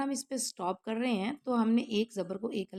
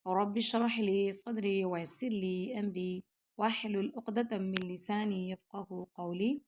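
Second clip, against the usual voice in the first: slow, soft opening dua; slow murmured prayer; prayer recitation; 2 semitones lower, 4 dB quieter, slower than usual. Real, not acted. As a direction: recite the dua calmly before class.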